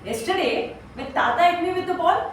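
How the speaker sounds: put-on high, loud child arguing back; indignant six-year-old. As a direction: imitate a small boy protesting unfairness. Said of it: "the reply that the six-year-old boy gave".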